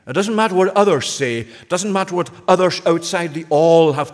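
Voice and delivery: a preacher's rising declaration; preaching loud. Real, not acted. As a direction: proclaim it to the whole room. Sermon rising to full force.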